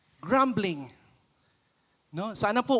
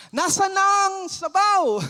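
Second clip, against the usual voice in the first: high, loud demand for the soup; impatient customer; 9 semitones higher, 7 dB louder than usual. Acted. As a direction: act a fed-up diner yelling for food. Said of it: "pero kunyari matagal".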